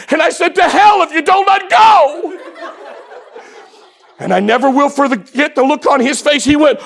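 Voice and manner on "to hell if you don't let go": high, loud, frantic retort; desperate child's yell. Retold or imitated. Eight-year-old boy yells back at his dad, desperate. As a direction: blurt it out like a scared kid.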